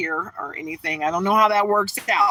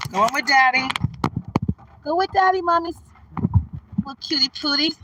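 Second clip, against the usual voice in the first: put-on high cooing baby talk to a pet dog; sing-song pet voice, much higher; 6 semitones higher than usual. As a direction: coo at the puppy like a baby.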